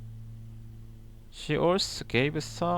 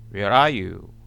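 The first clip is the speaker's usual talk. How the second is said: louder, lower call into the garden; 6 semitones lower, 7 dB louder than usual. Acted. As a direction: call out to someone hiding. God calls out to the man.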